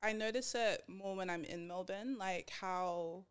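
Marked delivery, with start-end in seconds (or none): none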